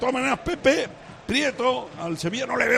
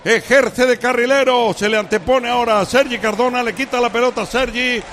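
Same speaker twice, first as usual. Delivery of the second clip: play-by-play; 3 semitones higher, 6 dB louder than usual. Acted. Live radio match commentary.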